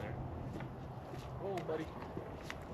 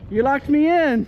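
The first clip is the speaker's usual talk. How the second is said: calling out; 10 dB louder than usual. Real, not acted. Locked in, calling to partner.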